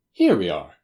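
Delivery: loud, cheery arrival call; cheerful, punched-out greeting; cheerily. A narrator's reading. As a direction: announce it brightly and heartily. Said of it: "said Holmes cheerily".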